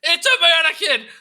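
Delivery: very loud, high panicked yell; startled shout. Retold or imitated. Player yelling to Lauren about the puppet, startled shout. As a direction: shout it like you've just been jump scared. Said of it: "I was like".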